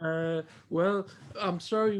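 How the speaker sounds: ordinary delivery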